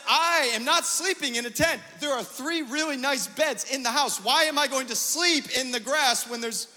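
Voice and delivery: high, loud, indignant refusal; indignant protest. Retold or imitated. Husband refusing his wife's tent plan, indignant. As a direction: protest loudly, pitched high and insistent.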